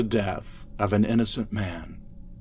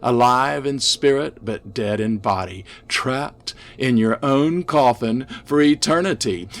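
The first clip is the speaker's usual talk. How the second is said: ordinary delivery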